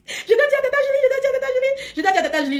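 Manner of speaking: high, laughing through the words; laughing repeat, fading to normal. Real, not acted. Laughing at the code joke.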